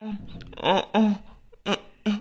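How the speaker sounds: faint broken groans, struggling for breath; weak groans.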